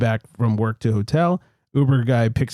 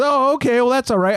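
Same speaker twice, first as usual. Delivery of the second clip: mock relieved driver; 11 semitones higher than usual. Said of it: "And he goes".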